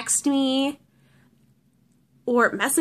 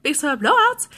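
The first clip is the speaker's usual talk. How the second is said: annoying alarm voice; 11 semitones higher, 4 dB louder than usual. Retold or imitated. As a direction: mimic a chirpy salesman on repeat. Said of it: "the guy's voice is really, really annoying".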